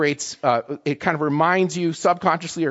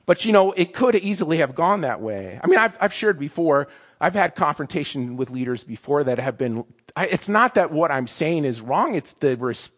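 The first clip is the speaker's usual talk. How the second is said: ordinary talk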